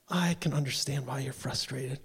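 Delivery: low, soft, measured sympathy; calm an upset caller, softly; soothing. Retold or imitated. Phone agent answering a frustrated caller, soothing.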